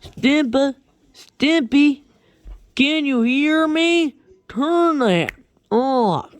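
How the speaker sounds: high, loud, slow put-on horse voice; calling Stimpy in a high strained voice; Mister Horse voice. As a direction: drag it out high like a cartoon horse. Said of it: "Mister Horse says something like this".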